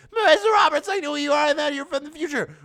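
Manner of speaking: very high, loud robot villain; shrill villain GLaDOS. Acted. GLaDOS confronting Marissa, very high loud villain voice. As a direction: squeal it like a cartoon AI.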